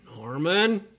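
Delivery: lightly voiced mother calling, pitch rising. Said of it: "I can hear my mother say".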